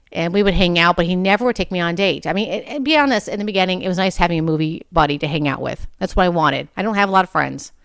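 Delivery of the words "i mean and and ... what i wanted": normal talking voice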